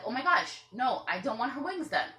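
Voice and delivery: high, loud panicked no; alarmed protest. Retold or imitated. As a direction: stop them mid-clip, alarmed. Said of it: "And I was like".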